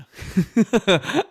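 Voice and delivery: high laugh bursting out; sudden loud laugh; burst of laughter. Real, not acted. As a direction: laugh at yourself. Laughing at own confession.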